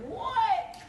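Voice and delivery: high-pitched, incredulous "what"; retold disbelief. Woman retells hearing she stole, high incredulous disbelief. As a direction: squeal it in mock shock. Retold shock at theft rumour.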